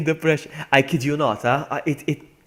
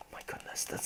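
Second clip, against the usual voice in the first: frustrated whisper; 18 dB quieter than usual. Real, not acted. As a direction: hiss it under breath. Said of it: "annoying creatures".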